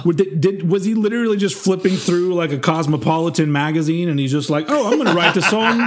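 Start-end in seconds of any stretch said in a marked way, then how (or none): 4.7-5.8 light, laughing British-accent mimicry; airy British toff deciding to write a song; mock British accent. Imitating the singer in a mock British accent. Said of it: "I don't know why he's British".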